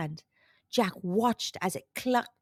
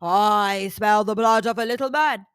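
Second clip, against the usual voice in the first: louder, menacing giant growl; booming giant; 6 dB louder than usual. A narrator's reading. Giant smells Jack in the kitchen, booming. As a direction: sniff out your prey aloud. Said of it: "bellowed a rumbling voice".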